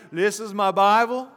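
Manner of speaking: hammed-up Texan drawl, line by line; mock preacher's creed in a Texan twang. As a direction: lead a call-and-repeat in a Texan drawl. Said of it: "with your best, best Texan drawl".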